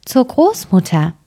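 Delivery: a hint of an innocent child; little girl answering. A narrator's reading.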